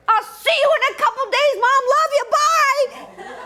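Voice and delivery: very high, loud singsong sign-off; chirpy goodbye. Retold or imitated. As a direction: squeal a breezy farewell down the phone. Daughter's breezy goodbye, then hangs up.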